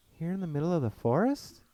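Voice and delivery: can't quite believe it; amazed. Acted. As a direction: narrator marvelling at the orchard. Still amazed at the trees.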